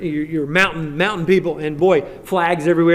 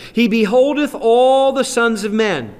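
higher, louder, very slow on the key line; scripture reading; 7 semitones higher, 4 dB louder than usual. Acted. Pastor proclaims Psalm 33 to the church, higher and louder. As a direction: proclaim the verse with weight.

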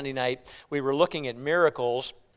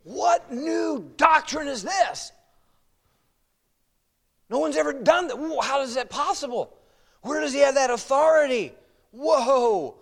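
astonished crowd; 9 semitones higher than usual. Acted. Preacher plays the people marvelling at Jesus, astonished. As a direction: exclaim in acted amazement. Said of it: "What do you think the people are talking".

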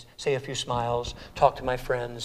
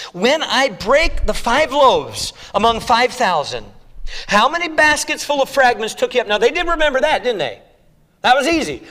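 full-force preaching; 10 semitones higher, 11 dB louder than usual. Acted. Preacher rousing the congregation, full-force preaching. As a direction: preach it to the back row.

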